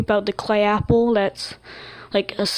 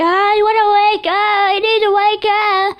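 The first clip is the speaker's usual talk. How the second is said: much higher, loud, desperate voice; panicked plea; 11 semitones higher, 5 dB louder than usual. Retold or imitated. Dreamer trapped in a dream begging to wake, high and frantic. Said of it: "I was, like, panicking".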